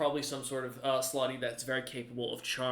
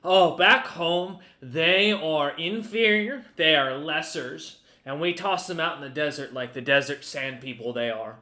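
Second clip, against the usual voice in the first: haughty scorn; 7 dB louder than usual. Acted. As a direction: lord it over the lessers. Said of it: "Crump looks up at you ... and goes".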